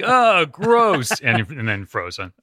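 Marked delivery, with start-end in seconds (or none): 0.0-1.2 high-pitched, hammed-up revulsion; comic yuck reaction; mock disgust. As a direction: act out a grossed-out sidekick. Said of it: "Robin's like".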